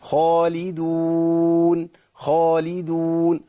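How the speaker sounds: خالدون chanted in a long, strained, exaggerated way; overdone recitation. Shown as a demonstration. Teacher mocking recitation that is too forced. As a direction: stretch خالدون slowly with too much effort. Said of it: "لو قرأنا القرآن دون تكلف".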